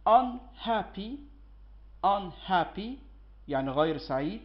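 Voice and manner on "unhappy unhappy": drawn-out teacher model; slow, clear classroom model; slow modelling. Shown as a demonstration. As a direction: stretch every sound for the class. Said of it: "آه، سابع كلمة".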